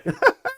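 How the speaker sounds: quick high laugh; short squeaky laugh; high giggle. Real, not acted. Reacts to compliment.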